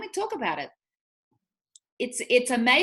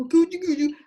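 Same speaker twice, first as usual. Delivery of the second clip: mock cooing, pitched higher and louder; baby talk; 8 semitones higher, 5 dB louder than usual. Mimicking adults talking down to kids, mock cooing. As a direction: coo at a kid in a mocking way. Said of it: "I listen to people and I go".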